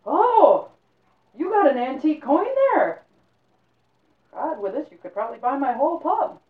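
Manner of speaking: loud, high amazed innkeeper; surprised pubkeep. Acted. Innkeeper spots an old coin, loud and amazed.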